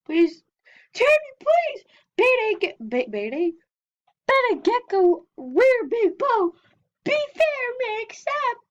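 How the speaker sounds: very high, squeaky mock begging; pleading. Player begging for a trade, playfully pleading.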